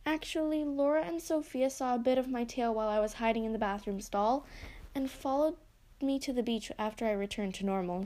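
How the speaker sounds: ordinary delivery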